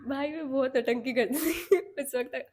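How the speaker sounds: real laugh breaking into the line; laughing while telling. Real, not acted. Telling friends about her old drama, laughing. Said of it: "अभी याद करी तो हंसी आ रही है".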